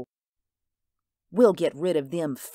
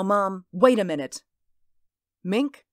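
ordinary talk, nothing marked

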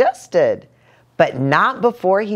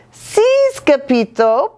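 panting; 8 semitones higher, 4 dB louder than usual. A narrator's reading. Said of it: "panted Poquito Tito".

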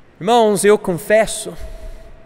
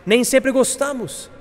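raised pitch on "nem sempre gostamos"; higher-pitched admission; 6 semitones higher than usual. Real, not acted. Preacher admitting dislike of useful things, higher-pitched. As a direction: lift "sempre gostamos" high, then let it fall.

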